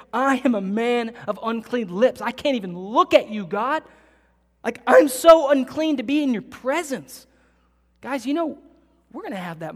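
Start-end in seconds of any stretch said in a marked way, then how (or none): 0.1-7.3 awed anguish. Acted. Isaiah before God.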